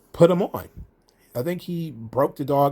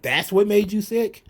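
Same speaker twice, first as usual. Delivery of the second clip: incredulous; 7 semitones higher than usual. Retold or imitated. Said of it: "You're like".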